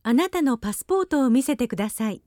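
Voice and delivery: normal talking voice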